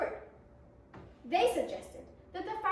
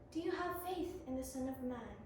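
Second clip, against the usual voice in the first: gentle; 5 semitones lower, 9 dB quieter than usual. Acted. Jesus gently asks her.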